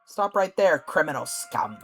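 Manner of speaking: loud, commanding halt order; guard shout. Acted. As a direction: bellow it like a city guard.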